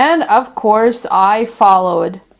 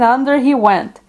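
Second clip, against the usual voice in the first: ordinary delivery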